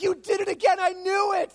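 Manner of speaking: high, loud mock yell at a friend; playful retold shout of "you jerk"; mock outraged shout. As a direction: yell it like you've been tricked, half joking. Same outburst at friend.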